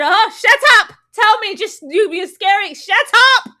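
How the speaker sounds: high, loud irritated snapping; fed-up girlfriend demanding answers. Retold or imitated. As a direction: snap at him to spit it out. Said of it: "she irritated".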